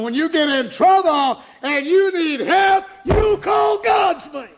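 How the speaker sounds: high, loud, slow, half-sung preaching; preaching at full force. Real, not acted. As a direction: ring it out to the congregation.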